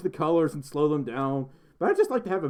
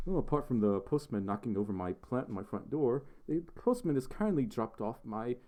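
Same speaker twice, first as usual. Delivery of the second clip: put-on a weak, half-hearted British accent, a little softer; 3 semitones lower, 6 dB quieter than usual. Streamer mimicking a British YouTuber's review, weak British accent. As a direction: try a British accent and don't quite land it. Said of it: "I can't do British accents".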